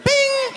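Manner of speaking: very high bright ding of an idea; sudden high chime as the idea strikes; mock bing. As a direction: sing out bing like a bell.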